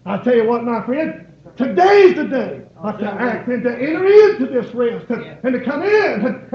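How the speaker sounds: full-force preaching. Acted. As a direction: lift the whole room with it.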